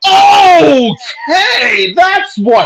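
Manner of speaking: high, loud burst of alarm; excited shout. Real, not acted. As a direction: cry it out in alarm. Breaking news of the injury.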